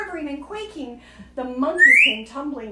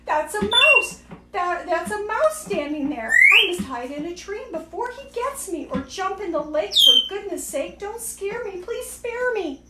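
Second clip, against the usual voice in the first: panicked elephant; 6 semitones higher, 4 dB louder than usual. A narrator's reading. Elephant scared of the mouse.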